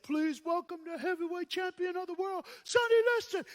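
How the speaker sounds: high, ringing announcer call, building to a shout. Retold or imitated. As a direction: announce the champion to a roaring arena. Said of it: "Then they said".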